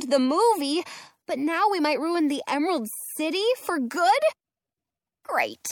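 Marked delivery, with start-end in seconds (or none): 5.2-5.7 low whispered great; dismayed sarcasm; sarcastic. A narrator's reading. Sinking realisation.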